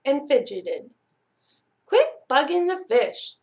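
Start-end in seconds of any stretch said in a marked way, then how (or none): 1.8-3.4 put-on snappish, higher-pitched kid's scolding. A narrator's reading. Sally telling her brother off about the fish, snappish. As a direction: snap it, pitched up. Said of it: "Sally snapped at Conrad".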